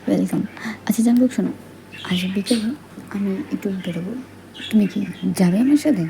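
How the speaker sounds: ordinary delivery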